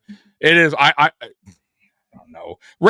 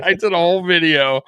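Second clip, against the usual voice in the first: ordinary delivery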